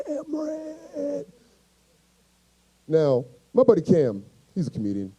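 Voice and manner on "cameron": high, soft, drawn-out strained call; feeble yelling voice. Acted. Injured man calling his homeboy, weak high stretched-out yell. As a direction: yell for a friend with your voice gone. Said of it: "that was my yelling voice".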